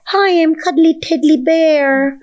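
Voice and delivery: high, slow, drawn-out cuddly cooing; girlish cutesy voice. Acted. Guest mocks the girl she remembers becoming, cutesy girlish voice. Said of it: "The girl talks like that".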